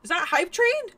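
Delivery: usual speaking voice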